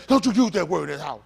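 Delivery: loud acted household reprimand; hammed-up stern telling-off; mock scolding elder. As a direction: scold like an indignant parent, loudly. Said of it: "and the first thing".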